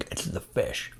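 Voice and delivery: breathy, disgusted whisper. Real, not acted. After tasting dead fish.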